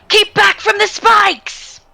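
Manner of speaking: high, drawn-out warning call. Acted. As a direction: call a warning out to your allies. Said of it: "I'm going to say".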